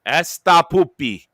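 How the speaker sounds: mock Spanish. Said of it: "wanna hear me speak Spanish".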